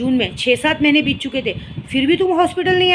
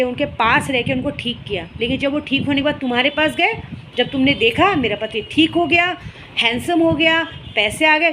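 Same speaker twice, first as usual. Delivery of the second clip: usual speaking voice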